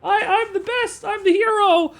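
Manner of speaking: squeaky hammed-up bragging nerd; mocking gamer. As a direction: mimic a smug kid gamer. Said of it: "they're gonna play R Q three and be like".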